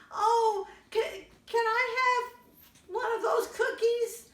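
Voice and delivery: put-on high pleading kid voice; high child voice. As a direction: ask like a small child. Child asking for cookie.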